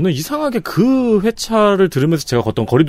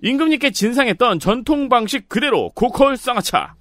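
higher, upbeat ad voice; infomercial; 9 semitones higher than usual. Acted. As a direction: sell it like a TV commercial. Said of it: "저는 이제 톤으로 알려드리는 거죠".

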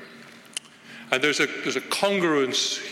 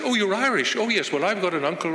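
mock delighted recognition; 7 semitones higher, faster than usual. Speaker imitating people abroad who learn he is Irish, mildly mock excited. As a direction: imitate a stranger gushing about having Irish relatives. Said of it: "They said".

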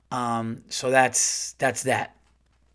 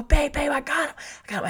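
much higher, excited cry of victory; excited triumph; 13 semitones higher, faster than usual. Retold or imitated. Husband telling wife he caught the hamster, excited higher cry. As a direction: shout it like you just won.

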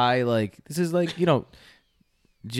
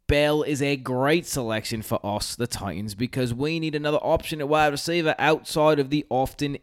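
a read-out scouting report in a hint of Australian; lightly Australian read; Australian accent. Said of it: "Started losing it a little bit".

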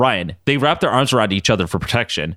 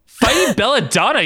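laughing; 12 semitones higher than usual. Real, not acted. Laughs mid-line.

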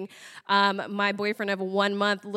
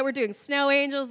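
ordinary delivery

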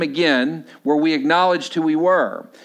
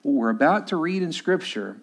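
softer, lower pastoral appeal; earnest sermon appeal; 5 semitones lower, 3 dB quieter than usual. Real, not acted. Pastor to congregation about fear, softer, lower and earnest. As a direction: quietly urge the congregation not to fear.

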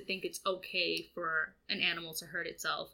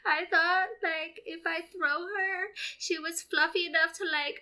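put-on much higher, slow, crying kid's voice; whiny son; 8 semitones higher, 8 dB louder, slower than usual. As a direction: whimper it out like a guilty little boy. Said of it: "He's like".

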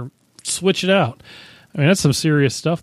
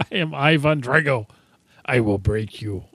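Ivan Drago voice. Retold or imitated. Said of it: "Dolph Lundgren from Rocky Four".

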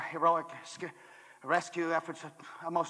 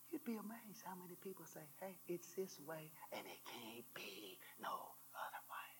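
whispered aside; 22 dB quieter than usual. Acted. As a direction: drop to a whisper for a confidential aside. Hushed aside to the congregation.

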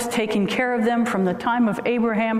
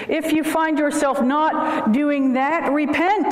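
preaching at full force; 6 semitones higher than usual. Acted. Preacher quoting Joshua forcefully.

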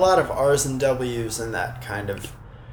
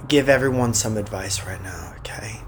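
breathy whisper, low and close; breathy sexy voice; 4 semitones lower, 4 dB quieter than usual. Pretend. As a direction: whisper it like a seducer. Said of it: "He's putting on his sexy voice".

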